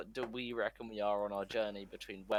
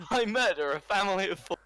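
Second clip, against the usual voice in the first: laughing; 9 semitones higher, 10 dB louder than usual. Real, not acted. Laughing at his own dark joke.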